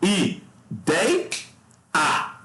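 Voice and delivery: I, dei, a with long pauses between syllables. Shown as a demonstration. Teacher shows students how to separate ideia, syllable by syllable. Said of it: "Você sabe como é que separa?".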